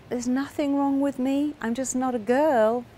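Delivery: high-pitched, lifted voice of discovery; dawning realization. Retold or imitated. Client seeing the truth about himself, dawning realization. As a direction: lift the pitch as the insight lands.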